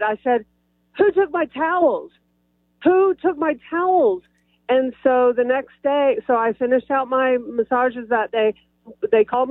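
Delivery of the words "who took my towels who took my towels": raised-pitch demand, only mildly loud; re-enacted calling out. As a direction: call it out higher, like asking down a hallway. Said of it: "I said it a little loud".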